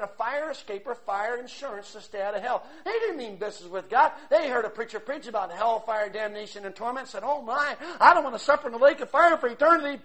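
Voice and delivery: loud, high preacher's cry; sermon at full volume; fiery preaching. Acted.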